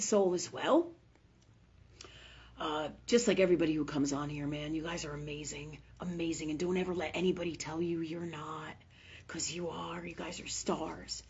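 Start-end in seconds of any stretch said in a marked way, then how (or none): 2.6-11.2 soft, low, tender encouragement; hushed and sincere, trailing softer as it goes; heartfelt reassurance. Real, not acted. Tearful reassurance, then says she'll cry.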